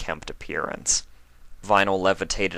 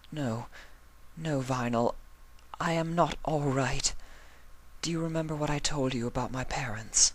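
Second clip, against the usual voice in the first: softer, higher voice, holding back tears; tearful Octavia; 9 semitones higher, 6 dB quieter than usual. Acted. Octavia telling Vinyl she is not all right, softly tearful. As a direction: miserable and quiet.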